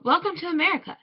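high, loud welcoming call. A narrator's reading. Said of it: "they called".

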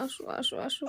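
whispered come here, come here; whispered coaxing. Real, not acted. Mother whispering to her crying child, coaxing. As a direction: breathe the words to calm him.

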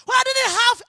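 loud, high-pitched insistence; forceful prayer. Acted. Giver reminding God of past giving, loud plea. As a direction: hammer it out as a plea.

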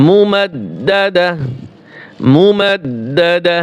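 মুমাদ্দাদা very slowly, each ending on breathed h; waqf stop. Shown as a demonstration. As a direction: recite মুমাদ্দাদা slowly, stopping on "dah". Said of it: "ওয়াকফের সময় উচ্চারণ হবে". (ধীরে, শেষে "দাহ" দিয়ে মুমাদ্দাদা)

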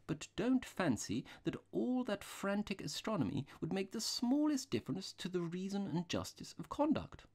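high-pitched priest, musing aloud; earnest little priest. A narrator's reading. Priest reasoning with his companion under the stars, earnest. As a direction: preach reason gently in a high voice.

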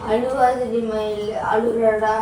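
low, slow comforting coo; soothing. Real, not acted. Woman comforting her crying little girl, soothing.

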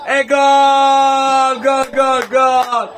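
full-throated goal yell; goal shout. Real, not acted. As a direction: scream it for the goal. Fan celebrates another goal. (urlo di gol)

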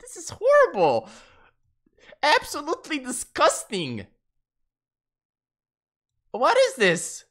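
high, loud, revolted wail; disgusted squeal. Real, not acted. Streamer revolted by his avatar image, squealing.

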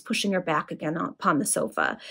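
plain reading voice

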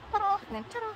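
cutesy mock fanfare; playful ta-da. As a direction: present it like a little magic trick. Showing banner front and back.

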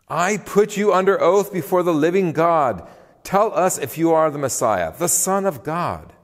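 high, forceful command under oath; forceful demand. A narrator's reading. High priest putting Jesus under oath, forceful demand.

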